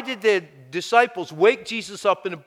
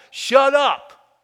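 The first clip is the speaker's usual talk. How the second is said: a loud, punched "Shut up", pitched higher; emphatic command; 7 semitones higher, 6 dB louder than usual. Acted. Moses telling grumbling people to stop, loud command. Preacher paraphrasing Moses to crowd.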